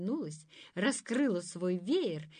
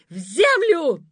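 loud furious yell; yelled spell; 9 semitones higher, 17 dB louder, slower than usual. A narrator's reading. Thorn-lady yelling the curse in fury. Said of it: "завопила".

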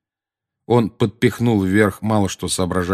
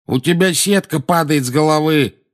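higher, louder cry while ducking; raised, urgent yell; shouting; 8 semitones higher, 5 dB louder than usual. A narrator's reading. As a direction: shout it in self-defence. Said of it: "крикнул наземникус".

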